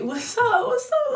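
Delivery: high, taunting what's up; mock challenge. Retelling Susie daring the woman, high and taunting. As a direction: squeal it out like a kid ready to fight.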